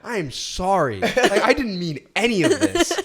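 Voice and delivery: high, loud pleading apology; exasperated mock apology. Driver to yelling niece, loud helpless apology. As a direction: plead it loudly, half laughing.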